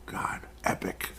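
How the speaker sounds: hushed, slow whisper; awed whisper. Real, not acted. Podcaster imagining Dallas against Colorado, awed whisper. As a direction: whisper it slowly, savouring the matchup.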